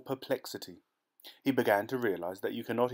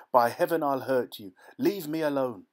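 higher, louder emotional threat; sobbing with emotion; 4 semitones higher, 5 dB louder than usual. Acted. Nunez threatens the pursuers, near sobbing. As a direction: plead and threaten at once, voice cracking. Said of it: "sobbing with emotion".

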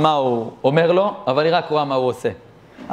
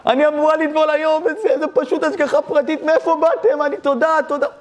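high-pitched, overwhelmed retelling through mock tears; imitated weepy gratitude; acted tearful outpouring; 11 semitones higher than usual. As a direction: pour it out high, as if crying. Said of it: "למה את בוכה?".

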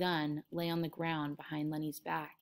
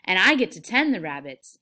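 loud, eager boast; gleeful, much louder exclamation; delighted; 9 dB louder than usual. Acted. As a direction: claim it proudly and excitedly. Lenny's delight, George answers subdued.

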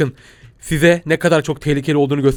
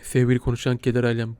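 solemn hush; 4 semitones lower, 7 dB quieter than usual. Acted. Grave address to audience.

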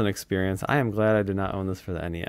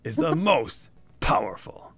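slow, theatrical villain read, fading to a whisper; villain's letter; slower than usual. Acted. Player reads the villain's letter to the turtles, slow and mildly menacing. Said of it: "he's trash talking".

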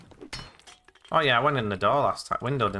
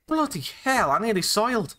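higher-pitched, rattled after a scare; shaken by fright; 8 semitones higher than usual. Real, not acted. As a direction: let the real fright lift your voice. Just had a jump scare.

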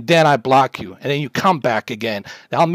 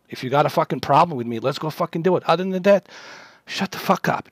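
ordinary talk, nothing marked

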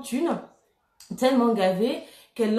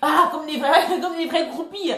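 laughing; 6 semitones higher, 4 dB louder than usual. Real, not acted. Finds the word she forgot.

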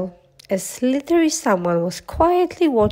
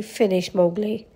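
usual speaking voice

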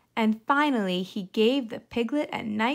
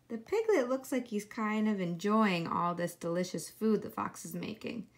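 ordinary delivery

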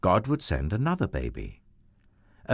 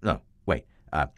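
losing count; 4 semitones lower, 3 dB quieter than usual. Acted. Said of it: "he kept losing count".

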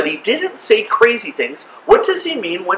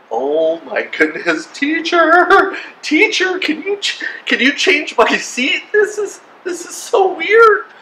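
high, hammed-up panicky pupil; freaked-out student; 9 semitones higher than usual. Student begs teacher to change seats, mock freaked-out. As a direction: squeak an urgent plea, starting slow then shooting up high.

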